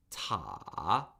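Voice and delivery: "ta" dipping low then rising; third tone. Shown as a demonstration. Teacher modelling tones for repeating, "ta" falling then rising. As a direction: start "ta" low, fall, then swing back up. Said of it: "it falls and then rises".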